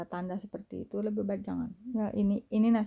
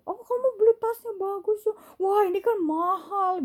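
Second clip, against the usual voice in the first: mock gushing; 11 semitones higher, 4 dB louder than usual. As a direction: overdo the envious compliment. Mimicking a nosy admirer. (pujian palsu yang dibuat-buat, tinggi dan lambat)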